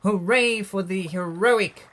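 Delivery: high, loud, celebratory chant; triumphant storybook hurrah; happy hooting cheer. A narrator's reading. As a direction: cheer the hero with stretched-out words. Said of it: "the duckbills hooted happily".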